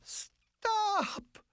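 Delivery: high sharp cry of stop; woman stops her lover's mouth with a high cry; cried out. Acted. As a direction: cry out to silence him, high. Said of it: "she cried out".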